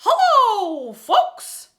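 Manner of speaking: very high, loud cartoon mouse squeak; Mickey Mouse voice. Shown as a demonstration. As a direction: falsetto cartoon mouse hello. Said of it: "Just take a nice breath".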